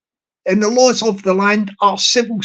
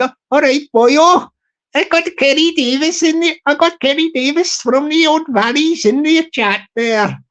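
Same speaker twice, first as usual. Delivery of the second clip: badly faked Welsh accent, pitched higher; 4 semitones higher than usual. Shown as a demonstration. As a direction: attempt a lilting Welsh voice that slips towards Indian. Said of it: "You know my Welsh accent".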